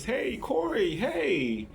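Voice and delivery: put-on high squeaky girlish greeting. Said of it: "in her squeaky voice".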